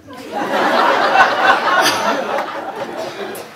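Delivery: high, loud burst of laughter; real shrieking laugh; big laugh. Real, not acted. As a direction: crack up at the absurd memory. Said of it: "laughing at library card".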